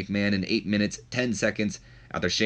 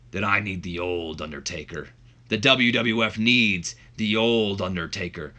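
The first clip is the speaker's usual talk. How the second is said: impassioned wrestling boss promo, badly imitated; horrible Vince McMahon. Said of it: "This is a horrible Vince".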